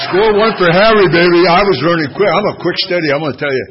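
loud, crowing brag to the room; triumphant loud crowing; gleeful boast. Real, not acted. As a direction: savour the win, loud and pleased. Boasting over the laughter.

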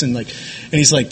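ordinary talk

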